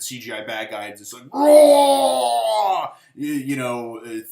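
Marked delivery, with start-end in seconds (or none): 1.3-2.9 huge, loud, high roar from a giant evil face; a big hammed-up monster bellow. Giant villain face roars.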